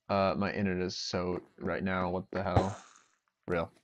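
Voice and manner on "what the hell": normal talking voice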